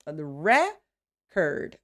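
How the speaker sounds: "record" slowed and split, REC... ord; long stressed first syllable. Shown as a demonstration. Teacher showing the noun's longer syllable, stressed REC in "record". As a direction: lean on REC in "record", then pause. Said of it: "the syllable record".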